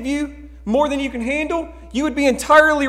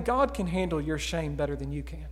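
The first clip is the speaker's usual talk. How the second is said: softer, low and intimate; quiet assurance; 7 semitones lower, 9 dB quieter than usual. Real, not acted. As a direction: say it close and gentle. Key point said quietly.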